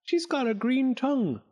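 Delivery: very high, astonished cry; amazed high-pitched exclamation; exclaiming. A narrator's reading. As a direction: marvel at the sight. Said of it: "exclaimed Clack".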